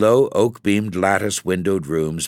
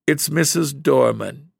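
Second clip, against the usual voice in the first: sobbing; 7 semitones higher than usual. Acted. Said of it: "she sobbed".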